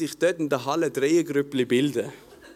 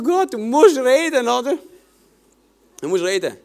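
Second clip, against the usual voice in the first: desperate prayer; 6 semitones higher, 4 dB louder than usual. Retold or imitated. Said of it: "am Heulen".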